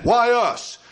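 acted "poor us" outcry; dismayed complaint. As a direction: blurt it out as an upset parent. Acted parents dismayed at conceiving.

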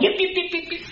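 high thin yaps like a little dog; squeaky little dog yips, soft; dog yipping. Retold or imitated. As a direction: yap like a tiny lapdog. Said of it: "talking like that little dog".

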